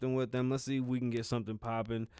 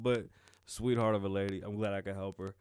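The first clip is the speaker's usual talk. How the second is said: usual speaking voice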